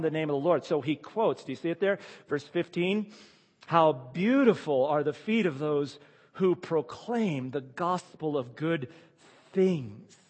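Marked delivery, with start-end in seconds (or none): 3.7-10.2 slow scripture quote. Acted. Quoted verse read slowly.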